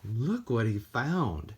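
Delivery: ordinary delivery, nothing marked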